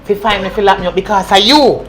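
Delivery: loud, angry accusation; furious shout. Acted. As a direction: hurl the blame at him. Zena furious at betrayal.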